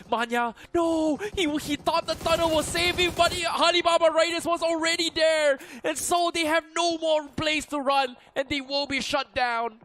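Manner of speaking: loud, high hype play-by-play; esports caster. Real, not acted. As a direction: call the wipe at full excitement. Caster reacts to team wipe.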